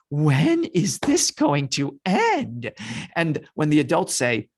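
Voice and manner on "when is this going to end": slow, drawn-out whine; hammed-up impatient kvetch. Shown as a demonstration. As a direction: complain with every word stretched. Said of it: "is to start fetching".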